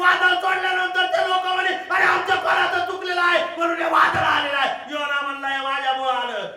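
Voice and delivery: shouted Bible retelling; preaching at full force. Real, not acted. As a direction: drive the Jonah story home. Preacher retelling Jonah loudly.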